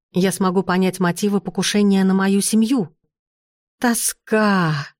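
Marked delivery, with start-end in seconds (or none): 3.8-4.9 drawn-out mournful moan; ghostly moan. A narrator's reading. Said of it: "вдруг простонало привидение".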